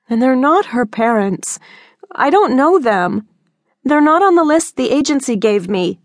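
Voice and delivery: put-on pleading teen correcting her mother; teen daughter, protesting. A narrator's reading. Daughter Jordan voiced by narrator.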